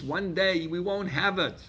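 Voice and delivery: ordinary talk, nothing marked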